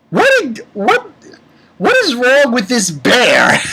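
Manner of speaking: high, loud, sputtering bafflement; hammed-up incredulous outburst; exasperated disbelief. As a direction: sputter high, then land loud and slow. Exasperated at the bear's behaviour.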